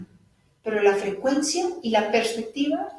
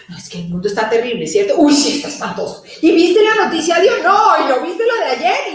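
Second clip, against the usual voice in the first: mock gossip; 4 semitones higher, 6 dB louder than usual. Mocking doom-and-gossip talk.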